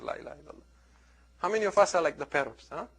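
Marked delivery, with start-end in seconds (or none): 0.0-0.6 hushed awe. Retold or imitated. Awed crowd quotes parrot.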